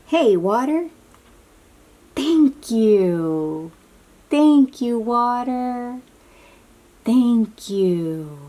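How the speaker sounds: big grateful shout to the water; loud, cheerful thanks. Acted. Reader to children, thanking water in a loud, bright voice. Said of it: "what do we say to the water?".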